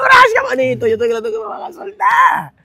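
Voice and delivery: grito agudo y feliz de alivio; respuesta eufórica gritada. Retold or imitated. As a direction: celebre gritando que lo sueltan. Responde eufórico creyéndose libre. (grito agudo y alegre de alivio)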